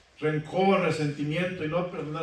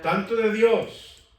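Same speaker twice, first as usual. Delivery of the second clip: "Tanto de Dios" slowed, higher and louder; emphatic stress; 5 semitones higher, 6 dB louder, slower than usual. Acted. Preacher stressing forgiveness from God to the brethren, emphatic. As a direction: lean hard on "Tanto de Dios".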